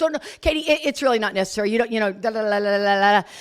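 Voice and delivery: mock-flustered, high-pitched deflection; imitated brush-off. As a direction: babble it away, imitating someone avoiding a talk. Acting out her flustered brush-off.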